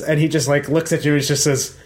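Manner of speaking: ordinary talk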